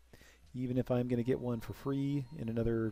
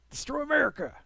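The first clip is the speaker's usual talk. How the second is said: much higher, louder, hammed-up villain voice; mock villain; 5 dB louder than usual. Gamer joking about his new Republic in a mock villain voice. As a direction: ham it up like a cartoon villain. Joke after becoming Republican.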